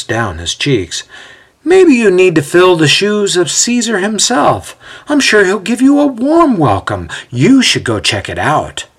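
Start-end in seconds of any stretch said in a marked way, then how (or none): 1.6-8.9 high, lilting mock helpfulness, dropping at the end; sarcastic retort. A narrator's reading. Othello goading Ezio toward Caesar, sarcastic retort. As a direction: offer advice as a taunt. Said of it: "Othello retorts".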